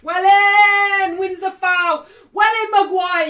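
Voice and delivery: loud, high cheer for the foul; celebrating. Real, not acted. Fan celebrating Maguire winning a foul. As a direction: shout it with joy.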